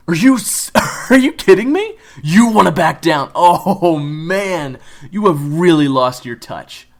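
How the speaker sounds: high, loud, laughing taunt; mocking laughter. David taunting Kyler loudly, laughing at him. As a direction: ridicule him between laughs.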